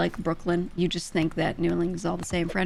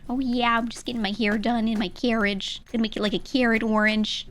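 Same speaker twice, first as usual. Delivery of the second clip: higher-pitched mock Midwestern accent; mock American accent; 4 semitones higher than usual. Streamer parodying how most Americans sound, mock Midwestern accent. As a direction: exaggerate the flat Midwestern American vowels. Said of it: "way most Americans sound to me".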